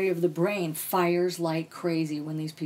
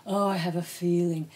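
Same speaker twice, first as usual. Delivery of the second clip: lightly put-on psychic voice; a hint of phony clairvoyant; mock psychic. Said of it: "these people who's like".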